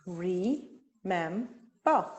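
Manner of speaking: Re-mem-ber said very slowly, one beat each; syllables split apart. Shown as a demonstration. Teacher counting syllables for students, very slow. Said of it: "How many syllables are in the word".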